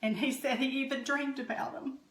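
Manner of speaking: fighting back real tears; choked-up proud mother; tearing up. Real, not acted. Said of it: "I tear up a little bit at this".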